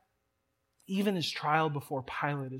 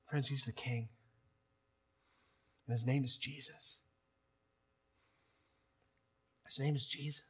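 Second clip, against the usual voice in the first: much softer, the name laid down gently; hushed reverence; 4 semitones lower, 10 dB quieter than usual. Real, not acted. Pastor quietly telling friends who the king is, hushed. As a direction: let it fall to a hush.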